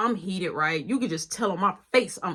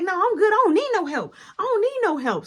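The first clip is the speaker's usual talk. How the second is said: put-on girlfriend's pitched-up angry refusal; high angry retort; 9 semitones higher, 4 dB louder than usual. Girlfriend refusing help, high and angry. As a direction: snap back high and hot.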